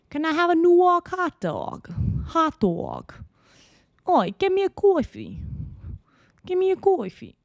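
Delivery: high, exaggerated New York accent, ordering food and coffee. Shown as a demonstration. Vlogger showing viewers her terrible New York accent, hammed up. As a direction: ham up a bad New York accent at a deli counter. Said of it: "Anyway, here we go".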